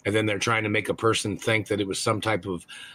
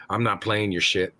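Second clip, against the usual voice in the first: ordinary talk, nothing marked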